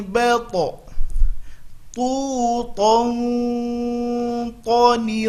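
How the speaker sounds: to ti tu ba to, firm and without breath; drilled to without breath. Shown as a demonstration. Teacher drills huruf to for the class. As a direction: chant to, ti, tu with no air puff. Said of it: "fokus jangan membunyikannya keluar hamas".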